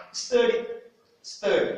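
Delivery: Study dit haut et fort; Study en modèle, voix haute; English model word. Shown as a demonstration. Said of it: "U devient E". (Study avec E, plus fort)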